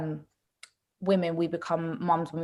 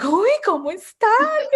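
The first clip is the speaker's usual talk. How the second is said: much higher, slow delighted greeting; mock-delighted mum, high and drawn out; excited mum; 5 dB louder than usual. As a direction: imitate a thrilled mum gushing hello. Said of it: "She got so gassed".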